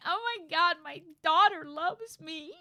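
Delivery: high, loud words breaking into laughter and tears; laugh-crying. Retold or imitated. Streamer overjoyed the game daughter loves him, laughing through tears. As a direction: gush through happy tears. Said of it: "And I'm like".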